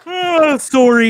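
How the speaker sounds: hammed-up polite Canadian sorry; mock Canadian sorry. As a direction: sing out a bright Canadian apology. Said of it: "He wakes up and he goes".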